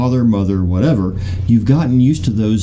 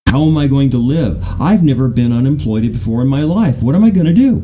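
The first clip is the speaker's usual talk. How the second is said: rising, louder panicked voice; panicking; 4 semitones higher, 3 dB louder than usual. Retold or imitated. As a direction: build into full panic over the job. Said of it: "And so they're panicking".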